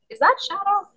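high, excited aunt voice; high-pitched excited auntie; aunt's excited question. Retold or imitated. Aunt voiced in retold story.

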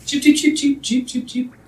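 put-on high, loud chirping baby bird; cartoon bird voice. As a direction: chirp it like a tiny chick. GM voicing baby griffin chirps.

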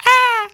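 sudden very high shriek; piercing scream of fright; retold shriek. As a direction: yelp in shock like a startled kid.

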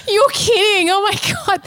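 high, breathless disbelief; stunned relief. Retold or imitated. Hearing the nurse say all is fine, high relieved disbelief.

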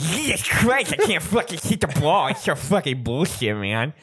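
slushy lisp on every s; hammed-up slurred sibilants. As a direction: complain through a heavy put-on lisp. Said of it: "like people with lisps".

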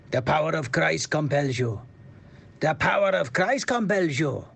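attempted Swedish accent on an exorcism line; Stellan Skarsgård. Attempting the Exorcist line, shaky amateur Swedish accent. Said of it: "attempted to impersonate how Swede American legend".

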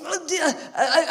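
ordinary talk

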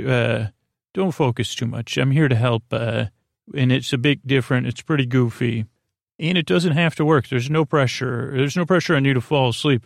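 usual speaking voice

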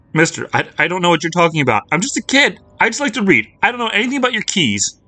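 put-on terrified child insisting she knows nothing; panicked girl. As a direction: babble out of fear. Lucy panicked, begging.

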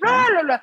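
high, sung-out nonsense trill; piping "lö lö" babble; imitated cleaner's noise. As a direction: mimic someone breezily barging in.

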